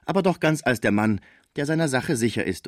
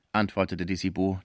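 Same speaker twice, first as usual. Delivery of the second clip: usual speaking voice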